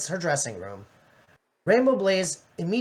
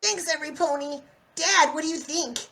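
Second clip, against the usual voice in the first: much higher, bright, brash pony; Rainbow Dash voice; 11 semitones higher than usual. Acted. Rainbow Dash thanking everypony and asking her dad. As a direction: pitch it up for Dash.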